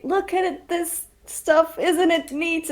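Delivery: bright excited high voice, showing off the fork; gleeful show-off. Acted. Player's character showing off her fork to the goblin, excited high voice. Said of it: "I will say".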